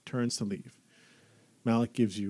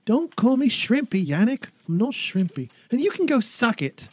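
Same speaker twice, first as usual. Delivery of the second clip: put-on high, loud bratty boy; whiny angry kid; 13 semitones higher, 7 dB louder than usual. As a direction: yell back like an offended little brother. Young son yelling at brother.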